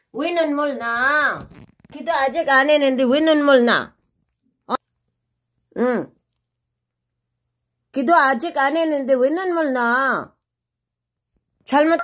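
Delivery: loud, stern scolding. Real, not acted. Mother scolds whining dog for eating before prayer, loud and stern. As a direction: tell off the dog firmly and loudly.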